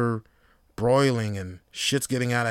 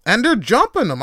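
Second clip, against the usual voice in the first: ordinary talk, nothing marked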